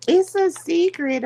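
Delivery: high, coy imitation of the server; teasing high voice for the guy; mock secretive waiter. Server teasing customer about recipe, high coy voice. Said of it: "the guy was like".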